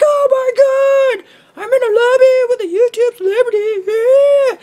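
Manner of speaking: very high, loud starstruck shriek; fanboy squeal. Retold or imitated. Player in a lobby with a YouTube celebrity, very high loud shriek. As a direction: shriek like a giddy fanboy meeting his idol. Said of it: "I don't really sound like that".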